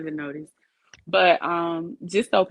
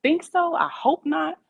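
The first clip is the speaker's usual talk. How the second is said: quoting herself; 10 semitones higher than usual. Retold or imitated. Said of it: "I was saying".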